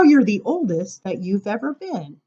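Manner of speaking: ordinary talk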